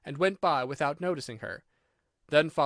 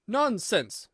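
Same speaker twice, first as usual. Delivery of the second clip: firm, raised-pitch retort; moderately loud, high dismissal; loud and decided; 6 semitones higher, 4 dB louder than usual. A narrator's reading. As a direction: cut her off decidedly. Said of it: "very loudly and decidedly".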